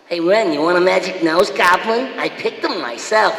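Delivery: high, childlike cartoon simpleton; Stimpy. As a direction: offer it proudly in an amped-up, happy cartoon voice. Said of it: "I just did Stimpy".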